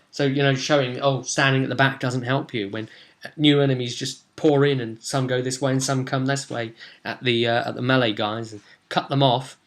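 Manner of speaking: ordinary talk, nothing marked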